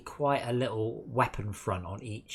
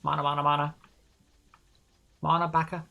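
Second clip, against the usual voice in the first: loud insistent calls for mana; calling out urgently to teammates; 6 semitones higher, 5 dB louder, faster than usual. Real, not acted.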